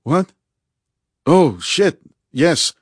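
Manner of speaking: high, loud, startled reaction; jolted, high-pitched realisation. A narrator's reading. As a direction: snap out of a daydream, voice jumping up. Ari startled out of daydream.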